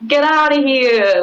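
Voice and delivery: mock New York accent. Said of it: "It's just gonna".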